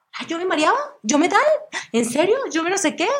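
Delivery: high, breathless listing of panic symptoms; squeaky rattled-off complaints; mock panicky self. Telling the doctor her symptoms in mock panic.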